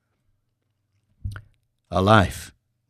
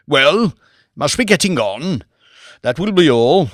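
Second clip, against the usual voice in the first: louder, a hint of a haughty lord; brusque lord; 4 semitones higher, 5 dB louder than usual. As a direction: dismiss them curtly. Lord dismisses them brusquely.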